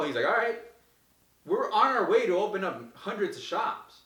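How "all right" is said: eager okay. Retold or imitated. Mechanic agreeing.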